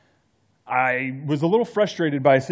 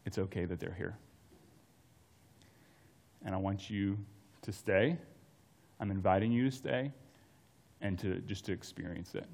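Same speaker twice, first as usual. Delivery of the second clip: much softer, lower, calm mentor voice; calm reassurance; 5 semitones lower, 8 dB quieter than usual. Retold or imitated. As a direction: soothe the angry young man, unhurried. Mentor calmly answers angry student.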